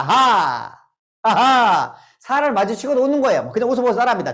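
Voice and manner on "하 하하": loud demo laugh; two big ha has, full of air; hearty ha ha. Shown as a demonstration. Lecturer leading the crowd in a loud laughing exercise.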